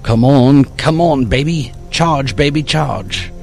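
will the dead phone to life, pitch up; urging it on. Acted. Dave urging the phone to charge.

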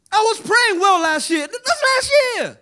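high, loud mock and rebuke; preaching shout. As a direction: shout it back from the pulpit. Mocks congregant then answers shouting.

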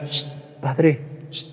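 softly hissed call for attention; furtive hush. Retold or imitated. Nosy lady privately calls the priest aside, hushed mimicry. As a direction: imitate a parishioner secretly getting the priest's ear. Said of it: "no quieren que nadie oiga".

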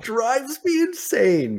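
high, drawn-out mock whine; exaggerated whine. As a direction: stretch it up high and whiny. Repeats his complaint, exaggerated.

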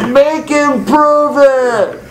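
loud, high, slow shouted dare; screamed challenge. Retold or imitated. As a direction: bellow a challenge across the room. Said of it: "someone else screams out".

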